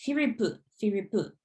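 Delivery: firipu with a crisp, sharp final pu; Japanese pronunciation. Shown as a demonstration. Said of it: "it's like a, a sharper sound".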